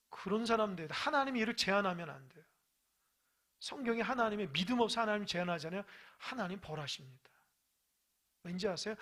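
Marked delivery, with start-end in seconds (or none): none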